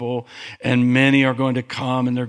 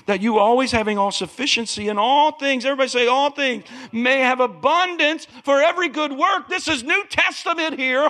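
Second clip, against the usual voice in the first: high, fervent sermon voice; preaching at full force; 10 semitones higher than usual. Acted. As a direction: preach it out with fire.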